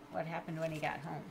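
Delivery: ordinary delivery, nothing marked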